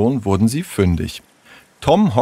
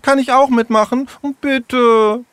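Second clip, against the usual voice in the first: much higher, asking sweetly; pleading little girl. Acted. Jule asks the friends to join, high and pleading. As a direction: beg to be let in the gang.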